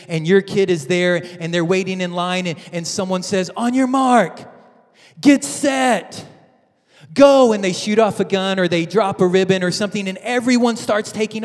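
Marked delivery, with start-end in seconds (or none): 3.6-7.5 rising, louder call building to a loud "go"; starter's call. Retold or imitated. Egg hunt starter calling the kids off, building to a shout. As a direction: call the race start across the field, building.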